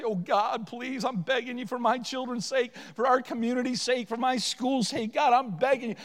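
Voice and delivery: impassioned, insistent supplication; fervent begging prayer. Acted. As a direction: beg God with full force. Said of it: "not prepared to say".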